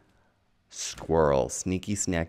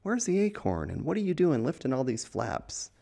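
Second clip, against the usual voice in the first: high, puzzled little bird voice; a bird baffled by the flaps; perplexed bird; 8 semitones higher than usual. Pretend. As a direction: squeak it out like a confused bird. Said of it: "That bird is like".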